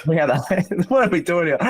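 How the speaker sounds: put-on half-hearted Scottish accent breaking into a laugh; a quick jokey Scottish accent, giggling at haggis; mock Scottish accent. Host tries a Scottish accent for cohosts, mock Scottish accent. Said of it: "good Scottish accent".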